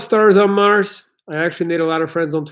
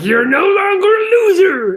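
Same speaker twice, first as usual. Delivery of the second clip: high mock motivational yell, much higher and louder; Tony Robbins yell; 5 dB louder than usual. Mocking a coach yelling at a crying man, high hammed-up yell. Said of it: "he yells at them and he's like".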